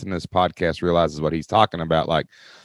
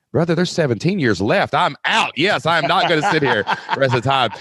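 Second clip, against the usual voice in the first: higher, animated retold reply; brushing off retirement with a laugh; mock emphatic; 7 semitones higher than usual. Teacher tells principal he is out, laughing and emphatic.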